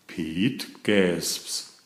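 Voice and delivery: usual speaking voice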